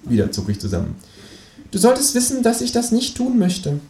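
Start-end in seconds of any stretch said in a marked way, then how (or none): 1.7-3.8 very high kangaroo interrogator; kangaroo, mock threatening. A narrator's reading. Kangaroo tormenting narrator for chocolates, high mock-menacing voice. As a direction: threaten solemnly in a high cartoon voice.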